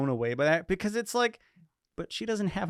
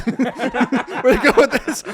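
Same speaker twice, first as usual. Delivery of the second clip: higher, louder and slow, laughing through the question; laughing disbelief; 8 semitones higher, 10 dB louder, much slower than usual. Retold or imitated. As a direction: crack up in disbelief. Repeats the quote laughing.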